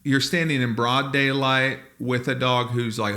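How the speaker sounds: ordinary delivery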